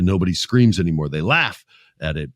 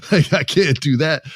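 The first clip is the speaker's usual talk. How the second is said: 1 exasperated disbelief; 7 semitones higher, 3 dB louder than usual. Retold or imitated. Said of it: "I'm like".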